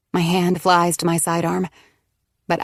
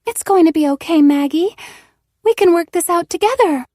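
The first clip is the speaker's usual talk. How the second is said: much higher, louder voice of Eve pleading; Eve's voice; 11 semitones higher, 6 dB louder than usual. Acted. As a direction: plead as Eve, the friend trying to help. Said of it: "in Eve's voice".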